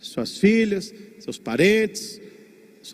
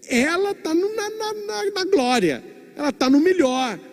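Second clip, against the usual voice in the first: rising joy; 11 semitones higher than usual. Real, not acted. Preacher exults about glory.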